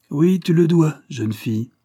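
high, loud emphatic interjection; stranger interrupting. A narrator's reading. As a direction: cut in firmly as an unknown voice. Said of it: "interrompit une voix étrangère".

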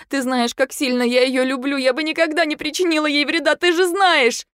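mother swearing she'd never hurt daughter; desperate pleading. A narrator's reading. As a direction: rise into near hysteria. Wife pleading, rising.

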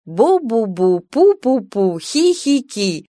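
playful babbling, rising to a giggle; mock whispering babble. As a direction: tease their secret chatter, pitch climbing. Mocking mother and daughter whispering. (шутливое бормотание)

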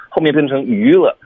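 ordinary delivery

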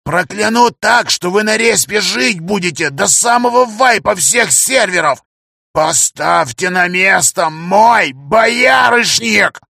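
high, slow shouted threat; menacing threat. Acted. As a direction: rage and curse them. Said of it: "зашипел он". (яростная угроза)

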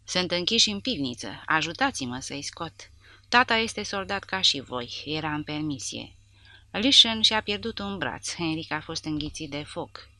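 ordinary delivery

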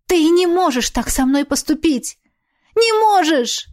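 high, rising desperate cry; desperate shout. Acted. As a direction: cry out in despair. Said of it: "закричала она".

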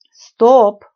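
sharp, loud "stop" cutting in; shouted interruption. A narrator's reading. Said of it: "крикнул Евгений". (резкий громкий окрик)

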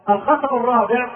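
normal talking voice

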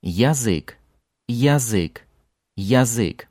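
Язык with a clear full ya, repeated; Язык: open strong Ya; full initial Ya. Shown as a demonstration. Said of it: "it's the full Ya at the beginning again".

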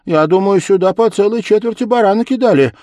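high, loud, lively chatter; excited girl. A narrator's reading. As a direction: share the discovery with your friends. Excited girl telling friends about sacks.

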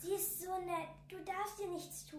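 put-on child quietly pleading with an unseen guest; hushed child voice. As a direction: speak softly to someone invisible. Child speaking to unseen visitor.

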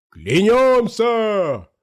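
high, long drawn-out cry; rolling, stretched shout of allegiance; crowd's oath. A narrator's reading. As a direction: proclaim it slowly like a chanting hall. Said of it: "прокатилось по залу".

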